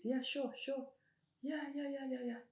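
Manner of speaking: high, sugary mock agreement; high fake-sweet yes-yes; fake agreeable. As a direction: play along sweetly while hiding annoyance. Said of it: "I'm like".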